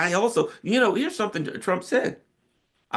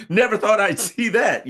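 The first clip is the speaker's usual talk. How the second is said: laughing; 9 semitones higher, 4 dB louder than usual. Real, not acted. Laughs at surprising Trump supporters.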